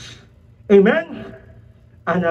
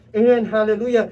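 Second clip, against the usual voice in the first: ordinary talk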